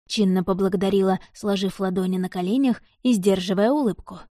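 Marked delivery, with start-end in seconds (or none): none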